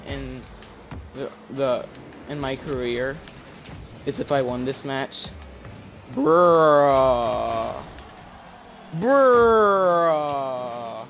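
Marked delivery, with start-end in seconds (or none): 6.1-11.1 long loud exasperated bruh; drawn-out bruh. Real, not acted. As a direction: stretch it out in disbelief. Disbelief at a play.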